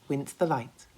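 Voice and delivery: ordinary delivery, nothing marked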